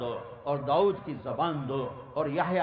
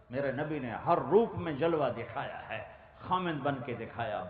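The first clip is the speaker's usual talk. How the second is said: ordinary talk, nothing marked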